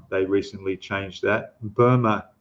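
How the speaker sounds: ordinary talk, nothing marked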